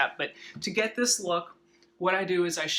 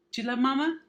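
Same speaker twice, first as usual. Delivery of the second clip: higher playful pet voice; talking to a dog; 8 semitones higher, faster than usual. Real, not acted. Cooing at the dog.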